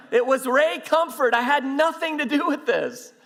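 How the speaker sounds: high, loud panicked denial; comic frantic self-defence; mock panic. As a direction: blurt out a hammed-up protest of innocence. Acted panicked denial to officer.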